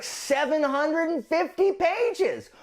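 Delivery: high, slow, drawn-out groan of disbelief with a laugh; mock exasperation. Real, not acted. Booktuber to viewers about a seven-hundred-fifty-page book, high laughing exasperation. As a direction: pitch way up and stretch it out, half laughing at the page count.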